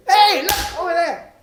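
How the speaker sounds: high, loud excited yell; sudden high-pitched shout; distracting passenger. Passenger yells out once more.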